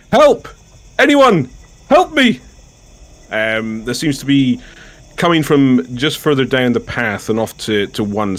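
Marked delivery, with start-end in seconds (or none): 0.1-2.4 high, strained cry for help; held-back shout for rescue; restrained yell. Acted. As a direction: call out desperately without fully yelling. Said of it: "I'm not gonna yell it, but it is a yell".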